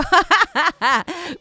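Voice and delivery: high, tickled laugh; real giggling laugh. Real, not acted. Host tickled by her own swooning, high laugh.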